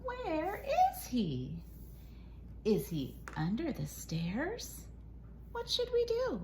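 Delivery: usual speaking voice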